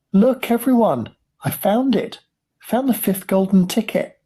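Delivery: put-on very high, thrilled boy's announcement; excited shout. A narrator's reading. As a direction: shout the news to the whole family, pitched high. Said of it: "he shouted".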